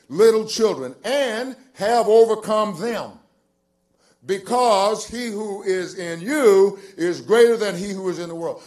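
loud, high, slow pulpit reading; stressed scripture reading. Acted. Preacher reading First John to the congregation, stressed preaching delivery. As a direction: stretch and punch the key words.

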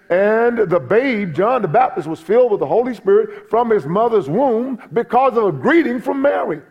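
fervent pulpit preaching. Acted. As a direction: lift the congregation with it. Preaching builds up.

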